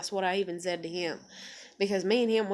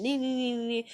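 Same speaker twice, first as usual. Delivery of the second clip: higher, singsong mimicry of whining; mock his rambling with nonsense syllables; dismissive imitation of yammering; mocking nee-nee noise; 4 semitones higher than usual.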